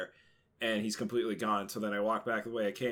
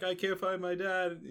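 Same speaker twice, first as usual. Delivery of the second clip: put-on lost little kid; 9 semitones higher than usual. Lost crying kid voiced.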